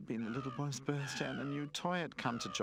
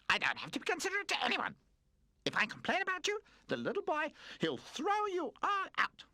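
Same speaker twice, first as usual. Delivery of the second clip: put-on high, loud, spoiled baby voice; bratty baby threat; 5 dB louder than usual. A narrator's reading. Pamper threatening to get the toys thrown out, bratty. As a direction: threaten them like a spoiled brat.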